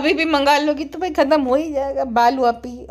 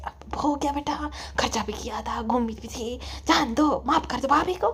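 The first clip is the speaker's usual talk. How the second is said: pacifying mother; 2 semitones lower, 9 dB quieter than usual. Acted. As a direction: gently talk your daughter down. (समझाती अम्मी की धीमी आवाज़)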